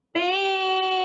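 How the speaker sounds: PEEEEN-ti, called out high with a stretched first syllable; calling out the word. Shown as a demonstration. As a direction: hold the PEN of Pente long on a high note. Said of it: "vamos chamar ela".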